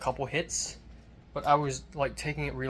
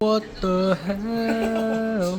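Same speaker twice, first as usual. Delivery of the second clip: shocked; 8 semitones higher, 10 dB louder, much slower than usual. Real, not acted. Reacts to something shown.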